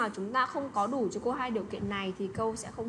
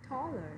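"taller" with TALL higher, ler falling away; stress on first syllable; 3 semitones higher, 5 dB quieter than usual. Shown as a demonstration. Teacher showing students the stressed syllable, first-syllable stress on "taller". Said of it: "đọc nhấn lên một chút".

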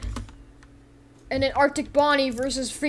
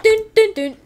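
high, loud sung-out beats; dramatic sting; 10 semitones higher, 9 dB louder than usual. Acted. Figure flying across the world, loud dramatic sting. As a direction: punch out suspense music. Mimics music as figure flies.

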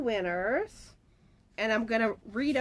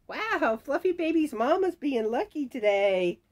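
excited congratulation; 5 semitones higher, 5 dB louder than usual. Real, not acted. Announcing a repeat prize winner.

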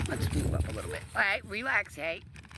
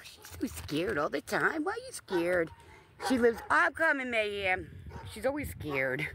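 ordinary talk, nothing marked

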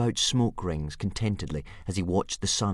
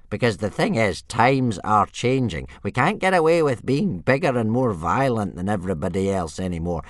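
put-on louder, urging old man; old soothsayer; 5 semitones higher, 6 dB louder than usual. Old Wrinkly to Hiccup, loud and urging. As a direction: rouse the boy with a vision.

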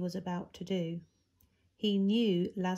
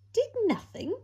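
much higher, louder disbelieving question; shocked repeat, voice leaping up; incredulous echo; 6 dB louder than usual. A narrator's reading. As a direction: throw it back in total disbelief. Incredulous echo of previous line.

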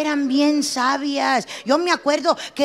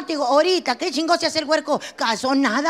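usual speaking voice

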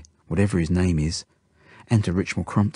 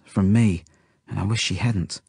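usual speaking voice

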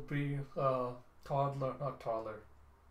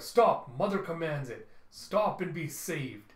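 loud, drawn-out command; commanding vampire; 3 semitones higher, 6 dB louder, slower than usual. Acted. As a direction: order them to halt like a zealot. Vampire calls to fleeing family.

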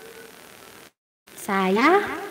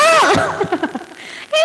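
laugh; 12 semitones higher than usual. Real, not acted. Laughs at example.

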